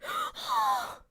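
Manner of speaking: loud, high gasp; huge fan gasp; excited gasp. Real, not acted. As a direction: gasp sharply in delight. Gasp on hearing a voice.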